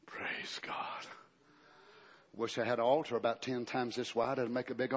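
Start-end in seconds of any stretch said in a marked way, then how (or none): 0.1-1.1 soft and breathy, nearly whispered; hushed. Real, not acted. Preacher quietly praising God after shouting. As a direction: let it fall to a hush.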